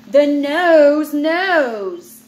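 slow, emphatic chapter title; slow, drawn-out heading. Acted. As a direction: stretch it out like a big reveal. Reading heading playfully.

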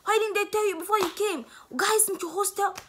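outraged mom. Retold or imitated. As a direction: scold like a shocked mother. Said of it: "She was like".

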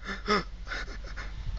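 breathy fake gasp; mock gasp. Comic fakes a gasp playing a weepy criminal. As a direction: gasp like you're about to cry.

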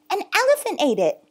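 high, quick, loud excuse; panicked. Acted. Boy without a ticket making an excuse, panicked. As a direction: blurt it out in a panic. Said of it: "Bob panicked".

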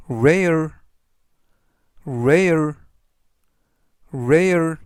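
rare said clearly with a falling end, three times; teacher modelling rare for learners to repeat; model pronunciation. Shown as a demonstration. Teacher drilling rare for the class, slow and clear. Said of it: "이라고 발음하시면 되겠고요".

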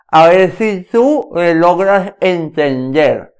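slow, careful words around a pencil held in the mouth. Shown as a demonstration. Diction coach demonstrating the pencil exercise to viewers, mouth full. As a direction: talk through a pencil clenched in the teeth, slowly. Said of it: "vas a hablar con el lápiz en la boca".

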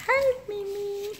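high, slow pet-talk greeting. Real, not acted. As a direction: coo it to the cat.

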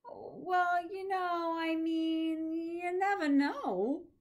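high, loud, slow fumbling comfort; awkward reassurance. Acted. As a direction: grasp for something to say. Mitch fumbling for words.

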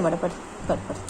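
soft whisper to settle it; whispered hush. Real, not acted. Owner whispering her pet to lie down, soft hush.

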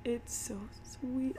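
soft, slow, moved to tears; choked-up tenderness; tearful. Real, not acted. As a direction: say it slowly and quietly, close to tears. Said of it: "I'm crying".

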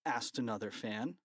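ordinary delivery, nothing marked

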